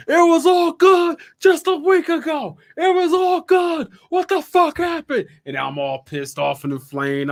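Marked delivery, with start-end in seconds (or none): 0.1-5.3 high, loud disbelieving whine; high exasperated whine. Retold or imitated. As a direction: whine it like a stunned kid. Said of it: "I'm like".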